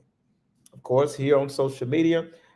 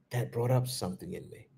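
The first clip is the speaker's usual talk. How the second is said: much softer, settled and unhurried; gentle, hushed acknowledgment; calm, quiet response; 3 semitones lower, 10 dB quieter than usual. Shown as a demonstration. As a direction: say it softly, like pausing to notice. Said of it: "that's what response sounds like".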